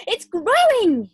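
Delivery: high, loud, slow cry; boy shouting to his grandma; excited yell. Acted. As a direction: yell it out, thrilled. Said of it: "he yelled".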